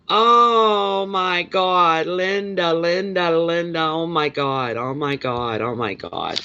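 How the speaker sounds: long high wail sliding gradually down; overcome with joy. Real, not acted. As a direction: draw out the first cry, then descend in relief. Overcome by Linda's good news.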